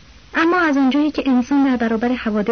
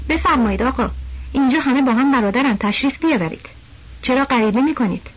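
plain reading voice

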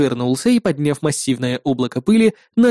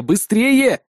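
higher-pitched hurry-up; urgent exclamation; 4 semitones higher than usual. A narrator's reading. Hero rushes his assistant mid-fight, urgent exclamation. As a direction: press her to be quick. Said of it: "воскликнул я".

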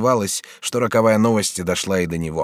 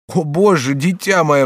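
mild alarmed cry; 6 semitones higher, 5 dB louder, slower than usual. Acted. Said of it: "вскрикнула бедная женщина".

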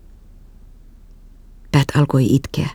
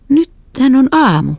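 high, slow, stunned; dumbfounded; 11 semitones higher, slower than usual. A narrator's reading. Girl stunned to see morning sky, high slow voice. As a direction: say it as if amazed it is morning. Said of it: "sanoin typertyneenä".

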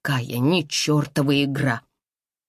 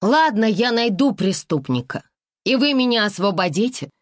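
hopeful exclamation; 6 semitones higher than usual. Acted. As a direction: exclaim it with hope. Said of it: "воскликнула я с надеждой".

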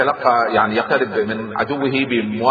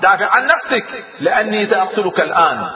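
high, raised, indignant challenge; furious commander's retort, pitched up high; angry outburst; 8 semitones higher, 3 dB louder than usual. A narrator's reading. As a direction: hurl it at the enemy in outrage. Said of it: "فغضب أدادير".

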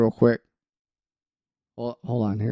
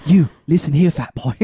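louder British put-on, barking at fat boy; British accent, bossy chef scolding; Gordon Ramsay British accent; 4 dB louder than usual. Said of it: "Gordon Ramsay impression".

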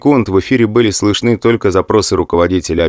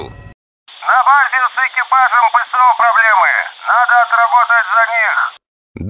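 excited radio voice. Acted. Mulin continues on radio.

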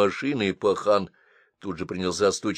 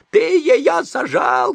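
put-on very high, slow old woman's shriek; old woman banging the glass, shrieking; old woman shouting; 8 dB louder, much slower than usual. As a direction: screech it at the back window. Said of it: "кричала она".

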